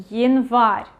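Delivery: Январь with Я reduced to a short yi, stress on the last syllable; reduced initial Ya. Shown as a demonstration. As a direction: say Январь as yin-VAR. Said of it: "pronounced as Y".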